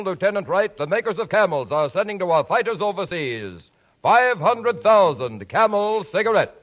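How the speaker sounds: ordinary talk, nothing marked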